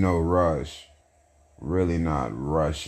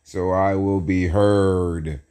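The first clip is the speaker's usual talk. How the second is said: slow, higher, stretched-out emphatic delivery; showy emotion; 5 semitones higher, 5 dB louder, slower than usual. Shown as a demonstration. Host proving he speaks with feeling, emphatic and drawn out. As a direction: declare it big, dragging the words out. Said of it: "I show emotion when I speak".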